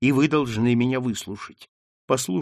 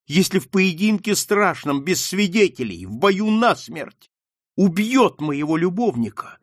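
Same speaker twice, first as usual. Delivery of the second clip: higher, louder, rising urgency; impassioned plea; 5 semitones higher, 4 dB louder than usual. Acted. Daughter's mounting plea to mother.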